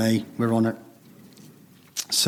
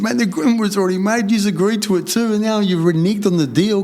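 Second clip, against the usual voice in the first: heated retort; 8 semitones higher than usual. Retold or imitated. Telling the family the deal was already made, heated. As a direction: tell them off for going back on the deal.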